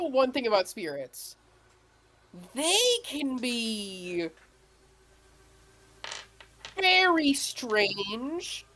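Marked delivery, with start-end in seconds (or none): 2.5-8.7 very slow, dramatic delivery; slow and drawn out. A narrator's reading. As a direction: stretch each word out for mystery. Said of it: "GM stretches for effect".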